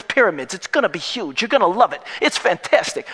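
high, loud Brooklyn renovation-show banter; hammed-up Brooklyn TV contractor; Brooklyn accent. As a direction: talk up the project like a Brooklyn builder. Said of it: "can't get away from the Brooklyn accent".

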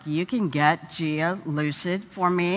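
usual speaking voice

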